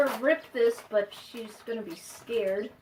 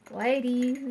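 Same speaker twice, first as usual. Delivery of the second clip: usual speaking voice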